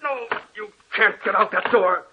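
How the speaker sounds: high, desperate cries, shoving someone back; panicked shouts pushing a ghost into the shelves; frantic struggle. Acted. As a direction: fight her off in terror. Fighting off a ghost at the door.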